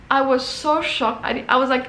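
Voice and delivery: ordinary talk, nothing marked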